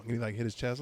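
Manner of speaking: ordinary talk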